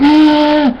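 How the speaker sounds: repeated high loud "Ah" to drown you out; hammed-up yelps cutting someone off; mock yelling over. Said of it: "and they're like".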